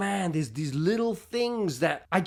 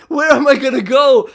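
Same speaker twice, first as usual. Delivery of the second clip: high, very loud cry of bafflement; acted loud, helpless exclamation; exasperated outburst; 8 semitones higher, 14 dB louder than usual. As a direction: shout the realisation in mock despair. Replays the thought as an outburst.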